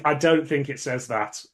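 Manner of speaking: ordinary talk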